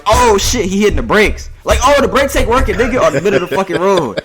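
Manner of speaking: high panicky yelp, put on; mock panic. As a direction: fake a brake-failure freakout. Shaquille faking brake failure.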